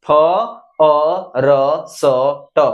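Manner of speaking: फ अ र स ट drawn out slowly, sound by sound; sounding out first. Shown as a demonstration. Teacher slowly spelling out फर्स्ट for the class. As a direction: stretch फर्स्ट apart into its sounds. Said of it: "फर्स्ट".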